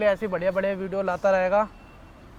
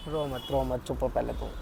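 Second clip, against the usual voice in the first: soothing; 9 semitones lower, 7 dB quieter than usual. Real, not acted. Boyfriend hushing his crying girlfriend.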